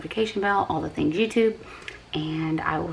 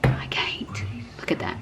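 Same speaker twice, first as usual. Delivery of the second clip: whispering; 9 semitones lower, 6 dB quieter than usual. Real, not acted. Overwhelmed by the food.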